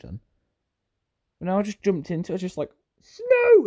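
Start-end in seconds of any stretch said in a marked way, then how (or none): 3.0-3.7 a sudden squealing high cry of "Snow!"; gleeful yelp. Acted. Player jumping into snow, a squealing high yelp.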